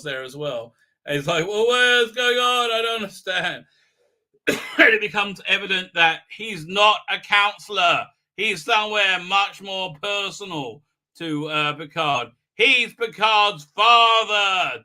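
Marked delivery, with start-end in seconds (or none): 1.5-3.6 mock bewilderment. Acted confused Picard. 12.5-14.8 loud, high, slow announcement; hammed-up plot twist, drawn out; mock dramatic reveal.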